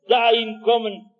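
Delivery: ordinary talk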